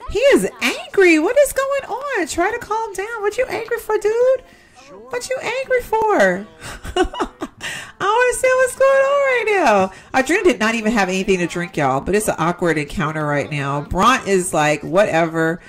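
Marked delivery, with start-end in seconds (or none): 0.1-9.9 high, startled questions; shocked reaction. Real, not acted. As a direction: react in disbelief at the angry guy. Sim suddenly angry, reacting.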